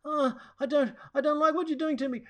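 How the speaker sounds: put-on high, fast, frightened child; fearful child voice. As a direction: imitate a scared little boy pleading. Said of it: "a fearful voice going on".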